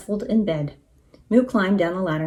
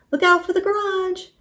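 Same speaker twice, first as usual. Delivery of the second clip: high-pitched warning call; warning cry; 11 semitones higher than usual. A narrator's reading. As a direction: call out the danger ahead. Shouted warning in wild sleigh ride.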